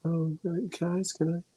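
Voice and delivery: quiet voice. Retold or imitated. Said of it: "Emma's nice and quiet".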